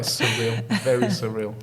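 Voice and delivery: ordinary delivery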